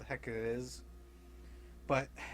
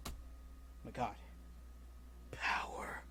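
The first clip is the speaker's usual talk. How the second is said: soft, breathy whisper of craving; greedy whisper; 4 semitones lower, 11 dB quieter than usual. Retold or imitated. Thanos awed by the cube's power, hushed greedy whisper. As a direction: whisper it like you crave it.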